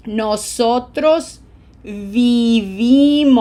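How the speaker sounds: very slow drill, the second VI of "viví" and VIE of "vivieron" long and strong; emphasized syllables. Shown as a demonstration. Teacher bugging learners to smile as they repeat, slow emphatic model. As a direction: stretch each verb out, punching the stressed syllable. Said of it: "syllables with pronunciation emphasis".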